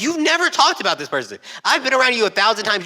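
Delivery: high, loud, indignant scolding; mock indignant rant. Scolding classmates who faked grief, high indignant. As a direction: tell them off with exasperation. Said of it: "I was like".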